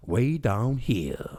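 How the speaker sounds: slow, stretched-out movie-star impression; Al Pacino. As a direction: draw each word out theatrically. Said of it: "You did Al Pacino".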